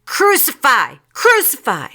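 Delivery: high, loud mob chant; shouting. A narrator's reading. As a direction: shout it twice like a baying crowd. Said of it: "they shouted".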